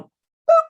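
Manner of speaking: a high, squeaky boop; boop sound effect. Retold or imitated. Tossing the joint out the window, high boop.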